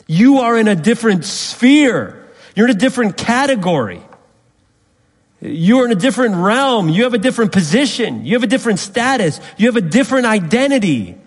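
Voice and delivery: high, loud, impassioned preaching; preaching at full force. Acted. Preacher to church, proclaiming their new identity, fervent and loud. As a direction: rise up and proclaim it to the congregation.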